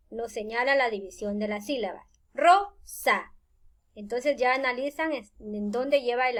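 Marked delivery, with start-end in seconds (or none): 2.3-3.3 Rosa split in two beats, ro-sa; syllable by syllable. Shown as a demonstration. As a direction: say Rosa with a clear gap between syllables. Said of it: "que cada golpe de voz".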